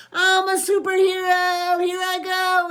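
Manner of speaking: put-on high, loud little-kid voice; proud four-year-old playing hero; little superhero boy. A narrator's reading. Four-year-old in mask and cape announces himself, high kid voice.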